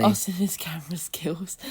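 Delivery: whispered teasing aside; under the breath, unvoiced; whispering. Real, not acted.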